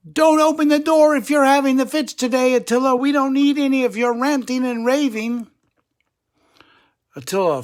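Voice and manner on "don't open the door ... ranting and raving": loud, high call through a closed door. Acted. Friends calling through Attila's door, loud and high. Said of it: "They called to him".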